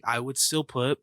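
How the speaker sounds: ordinary talk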